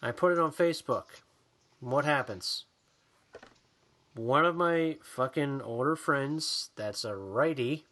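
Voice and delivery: ordinary delivery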